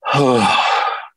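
long, heavy exhale; acted steadying breath; deep breath. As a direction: let out a slow composing sigh. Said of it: "and then take a deep breath".

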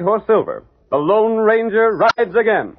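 slow, high, grand radio announcer; dramatic announcer. Acted. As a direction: stretch it out like a show's big opening. Announcer's dramatic show opening.

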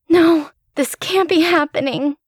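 high, panicked disbelief. A narrator's reading. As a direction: refuse to believe it in panic. Panic at seeing him bleeding.

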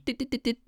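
high little chiptune beeps, "dit, dit, dit, dit"; imitating eight-bit beeps. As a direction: chirp "dit, dit, dit, dit" like an old game console. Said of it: "that throwback techie".

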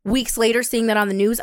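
normal talking voice

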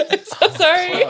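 high, laugh-filled apology. Real, not acted. Guest addressing the singer in case she listens, laughing apology. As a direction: apologise loudly through giggles.

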